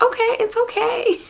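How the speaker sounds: high soothing mock voice of husband; husband comforting. Husband soothing his crying wife, high mock reassuring voice. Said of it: "he was like".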